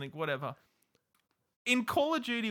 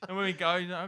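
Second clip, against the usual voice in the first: ordinary talk, nothing marked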